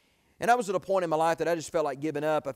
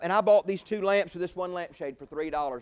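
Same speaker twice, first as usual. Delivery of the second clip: ordinary delivery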